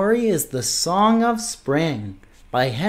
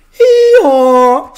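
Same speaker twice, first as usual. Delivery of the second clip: loud, high donkey bray; chirped hee-haw; 8 semitones higher, 9 dB louder than usual. A narrator's reading. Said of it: "The bird chirped".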